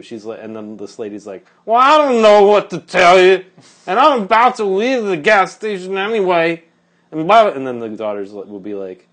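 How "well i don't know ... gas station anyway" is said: high, loud, worked-up retort; retold exasperated outburst. Woman on the phone brushing off her daughter, exasperated. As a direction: throw it back loudly, pitched way up.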